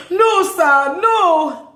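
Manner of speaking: high, loud mock plea; retold shout. Man protesting from the car as she walks off, high imitated shout. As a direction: cry out a drawn-out protest.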